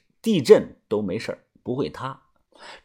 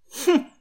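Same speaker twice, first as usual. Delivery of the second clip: high sharp scornful grunt; contemptuous nasal huff; cold scornful humph; 12 semitones higher than usual. A narrator's reading. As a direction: dismiss her with a snort. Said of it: "小轩冷哼了一声".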